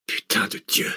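breathless whispered curse; murmured. A narrator's reading. Zach seeing the body among snakes, whispered curse. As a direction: whisper it in horror. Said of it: "murmura Zach".

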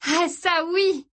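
high, slow, gleeful admission; blissed-out sister. Acted. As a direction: savour it dreamily. Sister admits taking ecstasy.

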